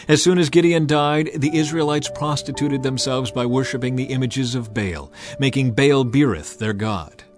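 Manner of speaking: ordinary delivery, nothing marked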